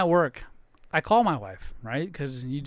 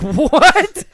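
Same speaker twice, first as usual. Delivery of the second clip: high, loud laughing "what?"; stunned, laughing what; shocked what; 8 semitones higher, 7 dB louder than usual. Retold or imitated. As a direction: yelp it in amazed disbelief. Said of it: "And I was like".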